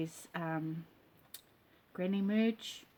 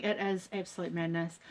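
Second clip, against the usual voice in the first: ordinary delivery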